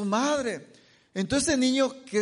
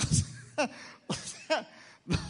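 laughing; 5 semitones higher, 5 dB quieter, slower than usual. Real, not acted. Laughing at own anecdote.

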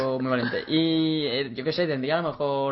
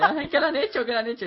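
much higher, panicked cry of a mother; a mock frantic mother wailing; imitating panicked mother; 9 semitones higher than usual. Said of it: "En plan de". (imitando a la madre asustada, aguda)

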